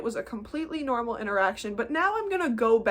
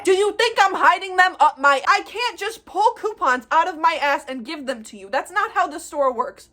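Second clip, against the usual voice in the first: loud, higher-pitched indignant rant; fed-up raised voice, emphatic; exasperated retort; 7 semitones higher, 7 dB louder than usual. Acted. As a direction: fire back at an unreasonable customer, loudly.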